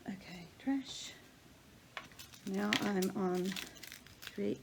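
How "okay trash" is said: whispered aside. Real, not acted.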